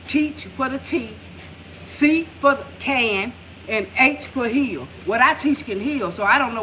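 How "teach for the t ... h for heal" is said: each letter laid out slowly, word by word; slow spelled-out emphasis. Shown as a demonstration. Spelling out what her witch stands for, slow and deliberate.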